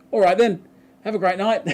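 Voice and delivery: high, loud, breezy farewell; cheery drug dealer. Retold or imitated. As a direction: imitate a dealer signing off merrily.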